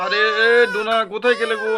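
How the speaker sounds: loud call for his wife. Acted. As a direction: call her from the other room. Husband calls wife from bed.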